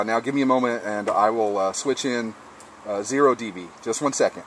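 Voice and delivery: ordinary delivery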